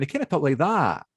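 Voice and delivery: lightly mimicked Edinburgh university accent; imitated Edinburgh accent. Mimicking a Glaswegian back from university, Edinburgh accent. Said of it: "got a bit of an R to it".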